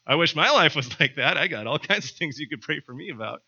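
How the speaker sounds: loud, laughing mock envy; playful laughing reply. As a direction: laugh it off warmly and loudly. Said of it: "I'll often respond like".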